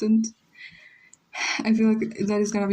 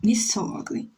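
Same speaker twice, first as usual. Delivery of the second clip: normal talking voice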